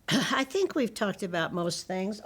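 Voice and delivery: plain reading voice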